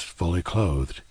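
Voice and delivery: ordinary delivery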